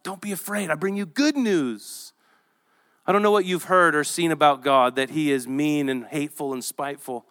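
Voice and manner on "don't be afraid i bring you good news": quiet reassuring angel; tender reassurance. Acted. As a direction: comfort them like a frightened child. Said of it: "And so he says".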